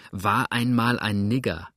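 usual speaking voice

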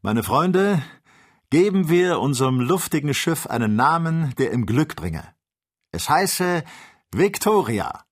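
loud, high-pitched proclamation; calling out. Acted. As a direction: proclaim it to a gathered crowd. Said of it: "nahm den Hut ab und rief".

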